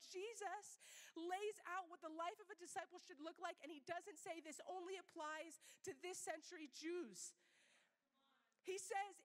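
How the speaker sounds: ordinary delivery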